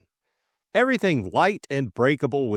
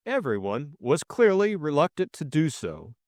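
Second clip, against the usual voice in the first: ordinary delivery, nothing marked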